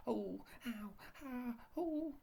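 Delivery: very high yelps while rolling; high cries. A narrator's reading. Everyone cries out rolling down the hill. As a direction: yelp tumbling downhill. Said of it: "cried everyone".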